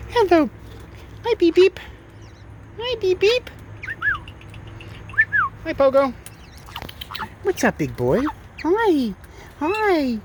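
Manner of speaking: very high, drawn-out cooing hello; slow high pet-greeting coo. Real, not acted. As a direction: sweet-talk the bird in a high voice.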